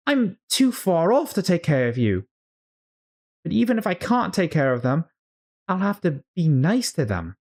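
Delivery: fretful Alice. Acted. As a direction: coo over her feet in a high, worried girl voice. Alice voiced, fretting over feet.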